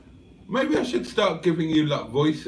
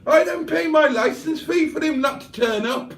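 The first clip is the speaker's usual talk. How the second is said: much higher squeaky put-on voice; silly character voice; 13 semitones higher than usual. A complaining viewer's message in a much higher, squeaky voice. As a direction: squeak it out in a cartoon voice. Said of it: "where's he from again".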